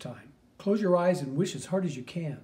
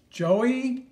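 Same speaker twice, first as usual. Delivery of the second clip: sudden, higher, louder call of his name; startled; 8 semitones higher, 8 dB louder than usual. Real, not acted. Mother spotting her son, startled. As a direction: catch him by surprise. Mom sees son in photo.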